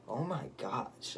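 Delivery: slow, stunned reaction; shocked. Retold or imitated. Teen reacting to the woman's remark, shocked. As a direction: draw it out in mild shock. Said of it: "shocked by that statement".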